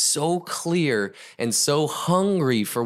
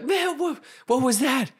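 very high, squeaky bewilderment; playing a startled student; shocked protest. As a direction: yelp it in disbelief after a dunking. Student surfaces after first dunking.

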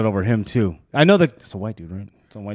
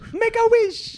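high sing-song; 3 dB quieter, much slower than usual. Acted. Blowing fuzz away.

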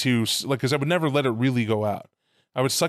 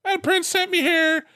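much higher, slow put-on spirit voice; voodoo spirit voice; 3 dB louder, slower than usual. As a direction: play the loa, slow and eerie, pitched way up. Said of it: "He'd be like".

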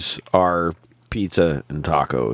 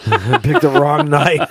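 laughing; 6 semitones higher, 4 dB louder than usual. Real, not acted. Laughing at guest.

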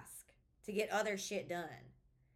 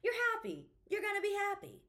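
high, mock-happy self-talk; toxic positivity; 12 semitones higher, 4 dB louder than usual. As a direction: parody a forced pep talk in a much higher voice. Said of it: "a lot of toxic positivity stuff".

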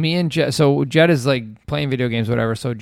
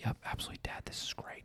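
barely audible, breath-only whisper; retold child's whisper; 23 dB quieter, faster than usual. Son agreeing to dad's secret plan, whispered.

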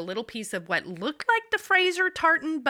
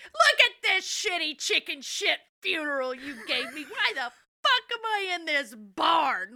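put-on cranky old woman; 7 semitones higher than usual. Dying widow scolding Roger about her funeral, cranky. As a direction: grumble like a sour dying grandma. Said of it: "trying to be a bitch about".

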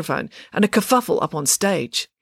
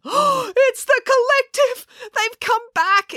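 gasp, then very high, drawn-out cry; shrieky alarm, stretched out in horror; panicked exclamation; 4 dB louder, slower than usual. A narrator's reading. Dad hears the helicopter return, exclaiming in panic. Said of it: "exclaimed Dad".

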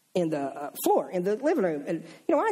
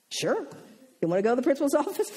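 playful sure; 6 semitones higher, faster than usual. Retold or imitated. Teacher playfully agreeing with girl.

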